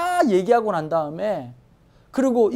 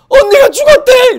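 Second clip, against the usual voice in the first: very high, loud cry of grief; mock wailing; 13 dB louder than usual. Relatives learning of Sarah's death, hammed-up wailing. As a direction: wail the news out, hammed-up. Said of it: "이러고 막 사람들 울고 막".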